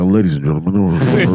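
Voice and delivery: low, slow cabin announcement; airline pilot voice. Retold or imitated. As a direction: announce it like the captain over the intercom. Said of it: "You sound like the fucking airline pilot".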